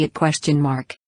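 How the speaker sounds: usual speaking voice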